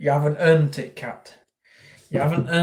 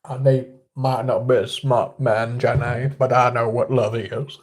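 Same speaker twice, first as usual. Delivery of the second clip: lower-pitched Southern US impression; Forrest Gump; 3 semitones lower, 4 dB quieter than usual. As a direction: play an earnest Southern film character. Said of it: "a bit like Forrest Gump".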